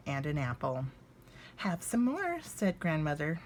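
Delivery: lively storybook narration, voicing each bear; reading to children. A narrator's reading. Reading Little Bear's Visit to viewers, warm storybook narration. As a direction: read it aloud like a picture book at bedtime. Reads a children's book aloud.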